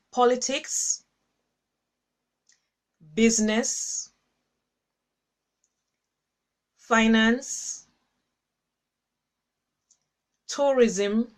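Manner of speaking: slow list with long pauses between items; deliberate dictation pace; slowly. Shown as a demonstration. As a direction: read each item slowly so viewers can write it down. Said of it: "I'll read them slowly".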